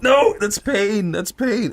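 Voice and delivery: high, drawn-out retold protest; pained protest. Patient correcting doctor about pressure, mock pained protest. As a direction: insist slowly, imitating a hurting patient.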